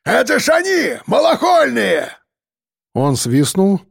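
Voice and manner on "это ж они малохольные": high, carrying shout; excited yell. A narrator's reading. Convict spots the fleeing pair, excited yell. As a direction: holler it across to the pack. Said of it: "заорал Сергеич, махая рукой".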